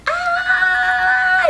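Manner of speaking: long, very high imitated scream; breakdown scream. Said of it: "having an emotional break".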